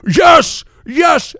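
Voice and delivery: high, loud imitated outburst; erupting shout. Drunk African man erupting at new wisdom, high loud shout. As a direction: erupt in mock elated shouting.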